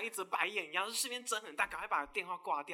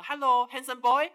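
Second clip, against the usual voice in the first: mock pickup line; 6 semitones higher, 11 dB louder, slower than usual. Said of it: "他们每次一个共通点".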